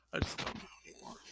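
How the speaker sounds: whispered, defeated admission; whispering. Real, not acted. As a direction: let it fall to a whisper. Sad confession, voice drops away.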